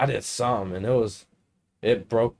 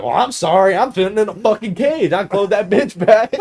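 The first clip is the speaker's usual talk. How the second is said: higher, louder mock-aggrieved retort; indignant protest; 7 semitones higher, 5 dB louder than usual. Grounded son answering back about the window, indignant protest. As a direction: protest it loudly, half laughing by the end. Acts indignant reply to scolding.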